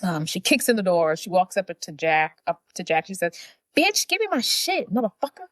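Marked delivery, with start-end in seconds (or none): 3.7-5.5 high-pitched, hammed-up angry demand; mock angry demand. Paige kicks in the door at Jack, mock fury. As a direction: storm in with a high mock tirade. Heroine storms in demanding her purse.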